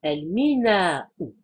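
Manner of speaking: "El Minaou" slowly, with the final "ou" sounded; vocalisation finale prononcée. Shown as a demonstration. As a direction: draw out "El Minaou" to its last vowel. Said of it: "C'est comme ça qu'on devrait le prononcer". (« El Minaou » lentement, avec le « ou » final)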